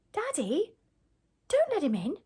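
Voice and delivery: high-pitched daughter voice; gatekeeper's daughter. A narrator's reading. Daughter warns her father, high-pitched pleading. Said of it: "the gatekeeper's daughter says".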